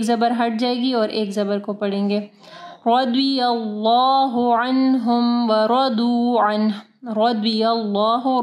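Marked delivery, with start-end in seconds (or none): 2.9-6.7 slow chanted रदियल्लाहु अन्हुम व रदू अन्ह; thick r, d and l, long laa in रदियल्लाहु; heavy ra and daad. Shown as a demonstration. Teacher recites for students, heavy measured tajweed. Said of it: "द्वाद को heavy पढ़े". 7.1-8.4 रदियल्लाहु with thick rounded r; slow रदियल्लाहु, full laa; heavy ra. Shown as a demonstration. Teacher models the word for students, heavy ra. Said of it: "रॉ को heavy पढ़ना है".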